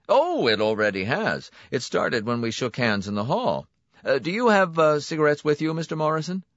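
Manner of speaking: a smooth salesman pleased with himself; brisk, confident host welcoming a client; Donati's voice. A narrator's reading. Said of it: "Donati asks for cigarettes".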